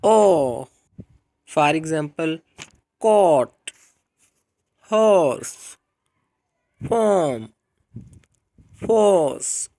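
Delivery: teacher demo of long aw, loud; long aw words. Shown as a demonstration. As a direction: draw out the aw in हॉर्स. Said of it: "चौथे number पे मोनोफ्थोंग long vowel sound है".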